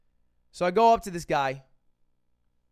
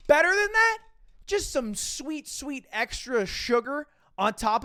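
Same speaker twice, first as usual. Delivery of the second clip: excited build; 7 semitones higher than usual. Real, not acted. Building to bonus news.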